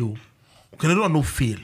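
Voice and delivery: normal talking voice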